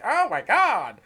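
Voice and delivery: high, swooping mock-scandalized voice; mock shocked listener. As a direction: imitate someone oversensitive being appalled. Mocks easily offended viewers.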